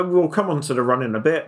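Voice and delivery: ordinary talk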